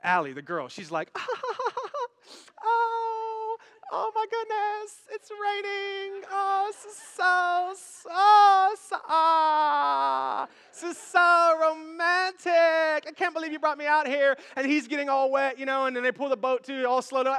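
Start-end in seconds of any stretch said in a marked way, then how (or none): 1.2-2.1 high fluttery put-on laugh; mock girlish giggle. Movie heroine caught in the rain, mock delighted giggle. 2.6-14.4 high, drawn-out mock rapture; swooning movie heroine. Allie to her date as rain starts, gushing mock swoon.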